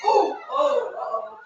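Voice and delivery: high-pitched startled little cries; sudden high squeals of alarm; startled yelp. Real, not acted. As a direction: yelp as if you just got zapped. Startled by shocking themselves.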